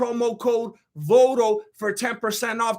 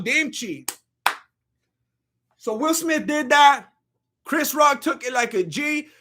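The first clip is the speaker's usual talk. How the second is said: usual speaking voice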